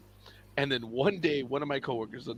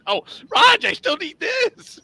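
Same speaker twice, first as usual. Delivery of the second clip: high, loud, drawn-out begging; mock panic at losing your turn; mock panicked whine, slow; panicked plea; 9 dB louder, slower than usual. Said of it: "people would get".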